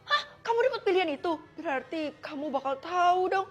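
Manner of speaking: normal talking voice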